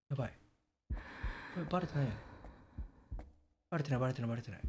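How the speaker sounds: hushed real nerves; tense hush. Real, not acted. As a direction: murmur it so it won't hear. Hiding, fearing detection.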